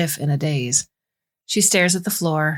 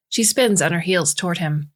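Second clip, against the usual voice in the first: plain reading voice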